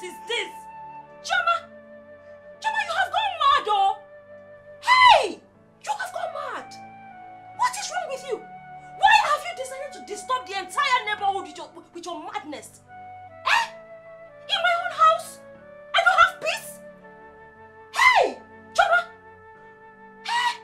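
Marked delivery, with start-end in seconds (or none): 1.2-8.2 high, loud angry bark; angry shout. Acted. Brother shouts at his sister to stop praying, furious. Angry man interrupts prayer. 13.5-20.6 high, loud angry yells; furious shouting. Acted. Brother yells at sister in his house, furious.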